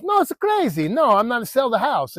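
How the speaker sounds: high-pitched mock protest; indignant Italian homeowner. Homeowner turning down a written offer, mock indignant. Acted haggling, owner refuses.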